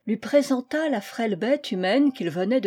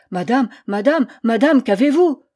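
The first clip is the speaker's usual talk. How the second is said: alarmed; 2 semitones higher, 8 dB louder than usual. A narrator's reading. Priest rushing to a moaning woman, alarmed. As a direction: call out in rising alarm.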